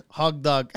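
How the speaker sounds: "Hog dog" with a G ending the first word; mother's mispronunciation. Retold or imitated. Son mimicking mom's hot dog, mispronounced "Hog dog". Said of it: "She says hog dog".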